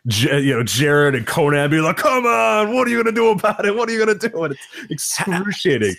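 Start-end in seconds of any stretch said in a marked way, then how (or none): 2.0-4.4 high, mock pleading demand; retold exasperated goading. Wrestlers goading each other through an endless stall, mock-exasperated. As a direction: imitate an impatient challenge, pitched up.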